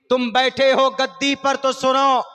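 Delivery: ordinary delivery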